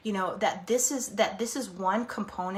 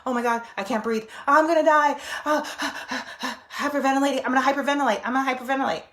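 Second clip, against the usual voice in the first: breathless imitated panic, louder on "I'm gonna die"; self-quote imitating panic, breathless and louder; mock panic; 5 semitones higher, 6 dB louder, faster than usual. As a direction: act out a panic attack, half-hearted. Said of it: "Notice yourself panicking".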